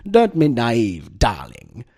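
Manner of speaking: loud, cartoonish haughty English voice; haughty British woman. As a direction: exclaim it slowly like a cartoonishly haughty British lady. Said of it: "this sort of haughty, uh, British woman going".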